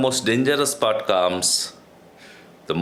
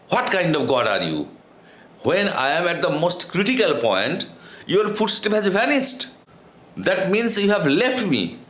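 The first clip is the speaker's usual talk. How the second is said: furious; 6 semitones higher than usual. A narrator's reading. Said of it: "Now the monk is quite angry. He became furious".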